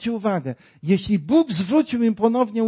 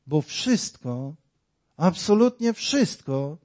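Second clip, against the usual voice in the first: ordinary delivery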